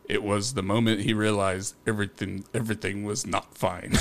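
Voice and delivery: lightly imitated narrator, low and soft; a hint of a deep, quiet voice-over; Martin Freeman. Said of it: "in a Martin Freeman voice".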